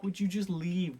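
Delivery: a put-upon woman waving him off; annoyed brush-off. Retold or imitated. Allison in bed, shooing away her admirer.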